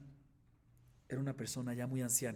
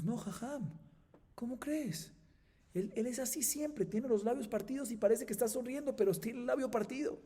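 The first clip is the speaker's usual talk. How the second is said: students reassuring; 11 semitones higher, 3 dB louder than usual. Acted. Students reassure their rabbi.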